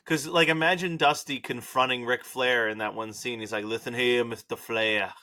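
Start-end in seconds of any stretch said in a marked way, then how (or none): 3.7-5.1 lisping, high, loud accusation. Pretend. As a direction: confront him with a lisp. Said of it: "your little lisp there".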